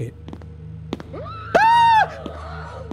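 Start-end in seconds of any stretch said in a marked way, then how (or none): 1.6-2.8 very high, sudden scream; real shriek of fright; startled scream. Real, not acted. Player hit by a jump scare, high startled scream.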